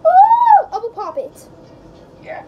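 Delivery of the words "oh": excited shouting. Real, not acted.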